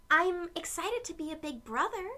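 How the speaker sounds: high and loud, proudly excited; excited answer. Acted. Aiden answering the paint guy, loud and proud. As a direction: proudly correct him. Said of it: "said Aiden".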